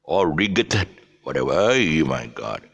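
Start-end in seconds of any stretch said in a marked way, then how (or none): 0.3-0.9 "rigoted" as RIG-ut; hard G mistake in "rigoted"; G sounded. Shown as a demonstration. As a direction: mangle right as "rigoted". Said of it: "to pronounce it as all right".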